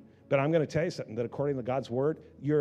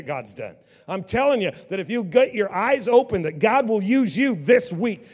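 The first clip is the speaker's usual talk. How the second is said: loud, high, fervent sermon climax, slowing on the last lines; full-force preaching; 7 semitones higher, 10 dB louder than usual. Acted. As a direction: preach it out to the whole congregation.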